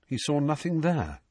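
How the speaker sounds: usual speaking voice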